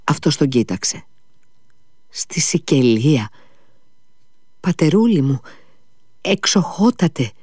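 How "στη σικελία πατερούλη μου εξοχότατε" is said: high, dazed bewildered plea; a dazed father answering the doctor, bewildered; lost and dazed. A narrator's reading. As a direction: sound utterly lost. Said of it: "είπε σαν χαμένος ο λοχαγός".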